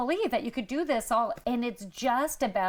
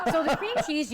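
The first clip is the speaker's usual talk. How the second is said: laughing; 7 semitones higher than usual. Real, not acted. Laughing after the sound effects.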